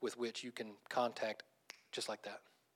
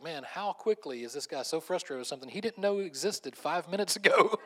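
retold disbelief, climbing higher and louder; incredulous comedian; 5 semitones higher, 6 dB louder than usual. Comedian baffled by the frustrated passenger, incredulous. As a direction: build to a punchline of mock outrage.

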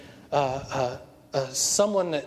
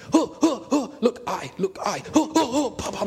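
high, punchy martial-arts grunts; high-pitched training barks; imitated sparring calls; 8 semitones higher than usual. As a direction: fire off mock karate shouts with each strike. Acts out Miyagi attacking in training.

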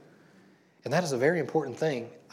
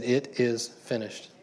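slow, weighty quote; much slower than usual. Shown as a demonstration. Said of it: "slow emphatic restatement".

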